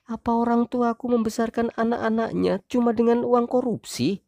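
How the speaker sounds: high wife voice, offended. Acted. Wife defending her parents, high voice. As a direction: bristle as the wife.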